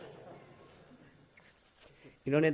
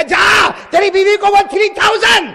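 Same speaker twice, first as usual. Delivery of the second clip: loud high scoffing dare; shouted mocking number; 12 semitones higher, 10 dB louder than usual. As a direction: shout it as an absurd joke. Preacher mocks the triple talaq.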